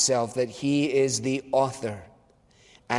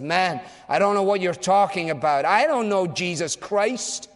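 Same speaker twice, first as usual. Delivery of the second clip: higher, louder defensive protest; Peter's denial; 6 semitones higher, 3 dB louder than usual. Acted. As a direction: push him away, denying Jesus. Peter denies knowing Christ.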